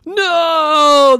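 high loud panicked cry; frightened cry. Acted. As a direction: cry out at the thought of falling. Fear of falling.

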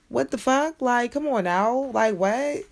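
high-pitched, drawn-out disbelief; incredulous. Real, not acted. Scolding a would-be boyfriend, incredulous. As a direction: pitch jumps up in exasperation.

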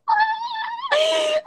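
high, helpless laughing fit; shrieking laugh. Real, not acted. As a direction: laugh till it sounds like crying. Cracks up after the poem.

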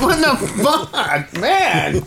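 laughing in disbelief; exasperated laugh. Retold or imitated. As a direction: cry out through a laugh at the cat. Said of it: "I'm like".

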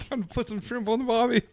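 high-pitched mock Aussie voice; a hammed-up Aussie barbecue line; Australian accent. Aussie cliché mimicry.

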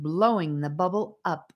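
plain reading voice

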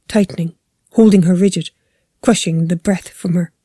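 usual speaking voice

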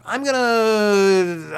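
long, held-out word while the idea forms; drawn-out realization. Acted. Man deciding his life's calling, drawn-out suspense. Acting out a life epiphany.

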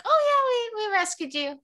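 high, loud mock-breezy voice; mocking cheerful. Reader mocking characters who announce a quick rescue, falsely chirpy.

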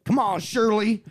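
mock Southern twang; Southern accent. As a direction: call to it like a Southern good ol' boy. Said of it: "but he's Southern".

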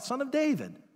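high, pleading cry to be heard; a blind beggar crying out for help. A narrator's reading. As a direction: call out for mercy over a crowd. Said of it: "he just begins to cry out".

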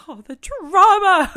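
high, loud, drawn-out theatrical cry; hammed-up melodramatic exclamation; mock dramatic. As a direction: wail it like an overblown drama queen. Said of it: "they're probably gonna be like".

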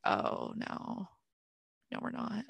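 hushed, near-whispered no; quiet imitated letdown; mock soft rejection. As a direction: breathe it out gently. Quoting rejection, soft unvoiced.

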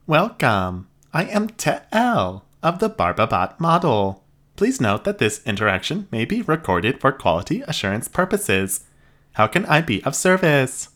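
high, even robot voice; polite machine receptionist. Acted. Robot greets them.

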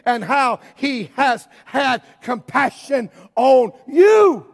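loud, high, driving sermon cry; preaching at full force. Acted. Preacher warning the church about the world, full-force preaching. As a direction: preach it out over the congregation.

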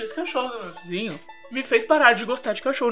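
ordinary talk, nothing marked

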